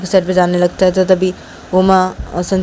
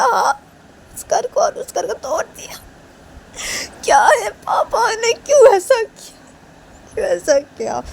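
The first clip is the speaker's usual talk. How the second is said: high, loud, wailing outrage; hurt fury pitched way up high; anguished angry outburst; 4 dB louder than usual. Acted. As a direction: rant in a high, tearful shout. Sanju rages over demolished house.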